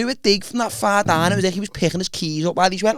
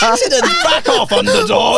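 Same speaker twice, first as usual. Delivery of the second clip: squeaky mock voice; 5 dB louder than usual. Mock taunt in high voice.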